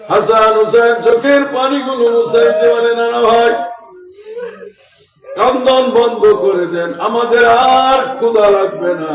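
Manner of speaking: high, loud pleading sermon; full-force preaching. Acted. Grandsons beg their grandfather to stop crying, impassioned preaching.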